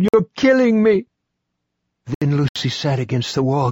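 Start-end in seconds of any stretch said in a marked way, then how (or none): 0.0-1.1 high, loud pained scream; an old woman screaming at her granddaughter in agony; anguished scream. Acted. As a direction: scream it as if the pain is unbearable. Momo screaming in pain.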